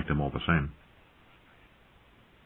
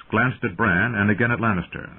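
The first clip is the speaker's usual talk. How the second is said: ordinary delivery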